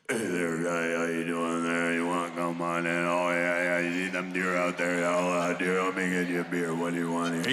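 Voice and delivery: very deep, low-pitched drone like a didgeridoo; Uncle Jack. Retold or imitated. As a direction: talk as if drowning in chewing tobacco. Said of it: "he talks like this. He talks like".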